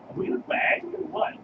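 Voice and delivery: mock exchange. Coworker confronting the shoulder rubber, hammed-up high voices. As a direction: play both voices over the top. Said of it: "be like".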